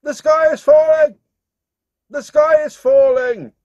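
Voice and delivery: high, loud, frantic cry; panicked shout. Acted. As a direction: shriek it like the sky is really falling. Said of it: "she started shouting".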